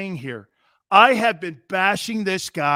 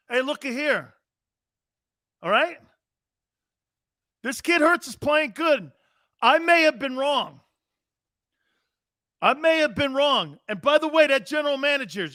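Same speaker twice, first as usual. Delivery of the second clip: higher-pitched, punchy sports radio take; emphatic Philly sports-talk delivery, pitched up; Philly sports-talk demo; 7 semitones higher than usual. Shown as a demonstration. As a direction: lay down the verdict like a Philly radio host. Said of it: "Let me show you how you do sports".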